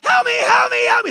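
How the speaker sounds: high, loud desperate cries; screaming for help. Retold or imitated. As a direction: scream like a damned soul streaming past. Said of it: "they're just screaming".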